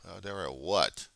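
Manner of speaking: ordinary talk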